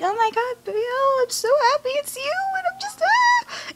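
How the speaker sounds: very high, mock-overjoyed squeal; gushing happily. Jinja delighted to see Bayal, imitated with excited squealing.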